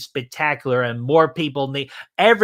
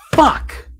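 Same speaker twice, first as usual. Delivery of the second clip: a sudden high, loud Fuck!; mock-outraged shout; outraged yell; 13 semitones higher, 5 dB louder than usual. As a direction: blurt it out like someone just stole your pick. Chat stole his crush.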